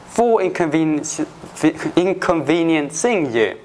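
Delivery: ordinary talk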